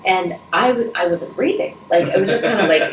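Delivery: ordinary talk, nothing marked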